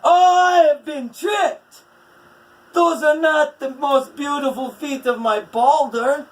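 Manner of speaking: very high, loud cry of shock; dismayed wail. A narrator's reading. Skadi realizing she was tricked, wailing loudly. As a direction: wail at being fooled.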